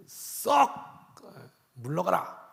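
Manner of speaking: a stern banishing hiss, drawn out; hissed stern command. Shown as a demonstration. Preacher showing how to banish an enemy, stern hiss. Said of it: "이래야 자연스럽잖아요".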